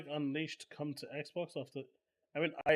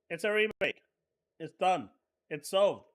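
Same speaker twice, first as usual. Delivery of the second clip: ordinary talk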